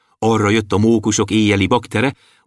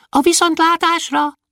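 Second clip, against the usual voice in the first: high goodbye call. A narrator's reading. Dwarf calls goodbye.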